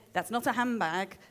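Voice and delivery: badly faked Scouse, sliding into Yorkshire; northern English accent, not quite Scouse; attempted Scouse accent. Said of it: "I can't do a Scouse accent".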